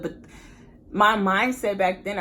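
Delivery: ordinary delivery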